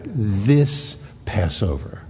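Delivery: slow, weighty this Passover; emphatic. Shown as a demonstration. Preacher stressing Jesus' words to the congregation, slow and emphatic. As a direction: dwell on each word, heavy.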